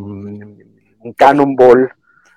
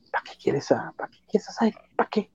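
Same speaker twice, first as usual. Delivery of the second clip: much softer, nearly whispered questioning; hushed objection; 7 semitones higher, 15 dB quieter than usual. Real, not acted. Fan questioning why pick Siren over Banshee, nearly whispering. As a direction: drop to an airy near-whisper. Repeats his objection under his breath.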